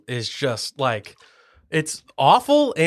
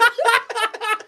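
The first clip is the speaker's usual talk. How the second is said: high laugh. Real, not acted. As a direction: burst out laughing. Big laugh.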